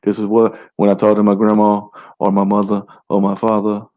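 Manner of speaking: rushed, defensive excuse; fast defensive mumble. Retold or imitated. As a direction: rattle it off defensively. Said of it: "That motherfucker be like".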